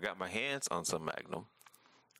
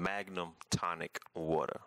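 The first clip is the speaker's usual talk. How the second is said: drawn-out, word by word; slow, careful repeat; slower than usual. Real, not acted. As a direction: spell it out slowly for the listener. Asked to repeat drink's name.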